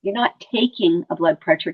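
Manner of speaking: ordinary talk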